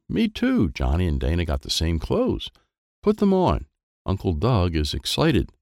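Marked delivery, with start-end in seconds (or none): 0.1-0.7 put-on high little kid's voice. A narrator's reading. Child voiced in story. 3.0-3.7 high eager call; excited voice. A narrator's reading. Character voiced in story.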